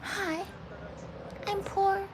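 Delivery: a light high mock of the movie line; quoting the actress. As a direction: plead softly like the poor girl. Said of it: "that line, the actress".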